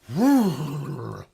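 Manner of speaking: loud, high exasperated exhale; heavy sigh. Real, not acted. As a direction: groan it out with relief. Tired effort outside.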